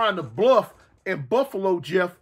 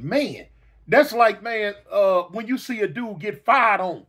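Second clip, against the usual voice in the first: ordinary delivery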